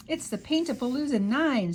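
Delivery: proudly. A narrator's reading. Rosie proudly showing June the invention. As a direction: present the invention with pride. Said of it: "said Rosie proudly".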